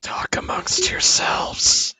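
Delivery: slow, joking whisper of the dead; whispering corpse. Acted. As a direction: whisper it slowly as a stalling corpse. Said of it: "It'll whisper".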